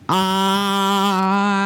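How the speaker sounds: long steady ah on the in-breath; sustained breath-in ah; held ah. Shown as a demonstration. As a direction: hold one even ah. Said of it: "speak when you are taking that breath in".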